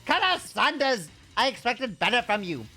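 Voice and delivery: stern, hammed-up German accent; comical German accent. Teacher scolding students for wasting food, comical German accent. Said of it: "accidentally did a German accent".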